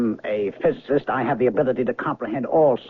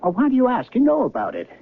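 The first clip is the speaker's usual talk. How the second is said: agitated insistence; 10 semitones higher than usual. Acted. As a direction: push him up high, bewildered and exasperated. Man insists she knows him.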